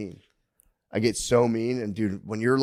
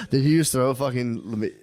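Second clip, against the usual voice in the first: normal talking voice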